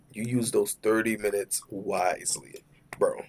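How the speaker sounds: slow whisper of "thirty minutes wisely". Shown as a demonstration. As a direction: whisper it slowly, knowingly.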